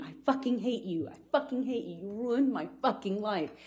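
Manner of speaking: usual speaking voice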